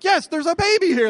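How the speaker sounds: high, loud acted outburst; exasperated yell. Father answering startled midwife, frantic exasperated yell.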